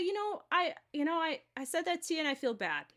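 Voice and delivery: apologetic friend. Retold or imitated. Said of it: "maybe they'll come through and say".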